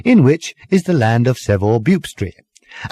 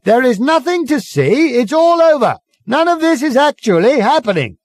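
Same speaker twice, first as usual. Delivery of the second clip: high, loud megaphone bellow; 13 semitones higher, 3 dB louder than usual. Acted. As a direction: bluff the crowd at full volume. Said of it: "Ford continued to bellow".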